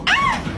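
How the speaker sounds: sudden shrill-pitched yelp; high yelp. Acted. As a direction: yelp in shock.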